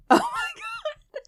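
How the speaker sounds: laughing. Real, not acted. Laughing fit.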